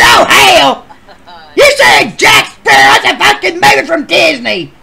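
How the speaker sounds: high, loud shout of disbelief; hammed-up shouted disbelief; outraged shout. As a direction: yell it in comic outrage.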